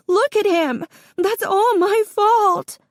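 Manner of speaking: very high, loud, slowing crying cry; anguished wail. Acted. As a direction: break down completely on the blame. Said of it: "this crying".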